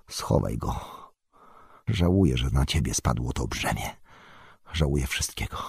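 soft, low, sorrowful apology; subdued regret. A narrator's reading. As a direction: speak quietly, weighed down by regret. Bilbo sorry for Frodo's burden. (ciche, przygnębione wyznanie żalu)

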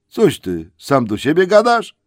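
baffled, question him loudly; surprised troll. Acted. Tom asks Bert, surprised, if he talks to himself. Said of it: "zdziwił się Tom".

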